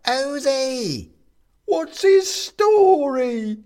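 very high, loud shrieking voice; squeaky toy. A narrator's reading. More toys crowd in.

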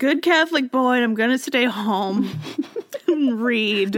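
high-pitched, petulant whine; whiny high voice. Pretend. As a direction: sulk it out like a spoiled kid. Mocking Copernicus staying home.

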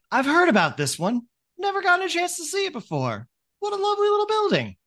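very high, loud falsetto welcome; excited ferryman. Acted. Ferryman greeting a new sight, excited. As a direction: squeal with delight in a high ferryman voice. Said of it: "very excited and welcome to see you".

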